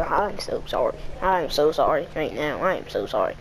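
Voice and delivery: soft, low, sorry; sincere apology. Real, not acted. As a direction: soften into sympathy. Sympathy for losers.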